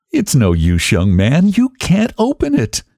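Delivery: mildly taunting, jumping high at the end; lightly mock the man locked outside. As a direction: rise to a high, teasing finish. Taunts husband behind locked door.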